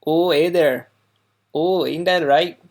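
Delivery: put-on folksy Wisconsin accent with long O's. Local demonstrates her state's old accent, broad Wisconsin. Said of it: "the Wisconsin accent".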